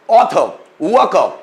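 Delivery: ऑथर, वर्कर said "aw-thuh, wuh-kuh"; silent final R. Shown as a demonstration. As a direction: model ऑथर and वर्कर with no R at the end. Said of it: "उसको silent बना दिया जाता है".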